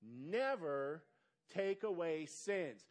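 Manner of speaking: very slow, deliberate reading of each word. Shown as a demonstration. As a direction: read it out slowly for the church. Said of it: "say it with me".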